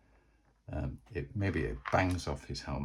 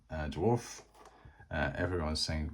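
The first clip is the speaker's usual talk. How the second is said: usual speaking voice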